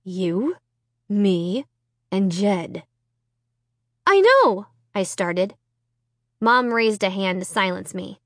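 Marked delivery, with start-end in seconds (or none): 0.0-2.8 tight-jawed controlled anger; gritted teeth. A narrator's reading. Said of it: "through gritted teeth". 4.1-4.7 urgent. A narrator's reading. Girl interrupting.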